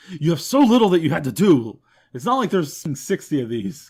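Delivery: loud, fed-up telling-off; exasperated rant. Real, not acted. Scolding Ma over the missing title, loud and exasperated. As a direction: blow up at Ma, mock furious.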